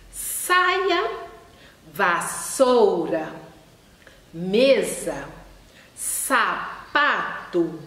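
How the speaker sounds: slow, one word at a time, S stressed in "Saia", "vassoura", "mesa"; teacher modeling S sounds word by word; emphasized S. Shown as a demonstration. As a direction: spell each word out slowly, leaning on the S. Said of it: "com ênfase aí na letra S".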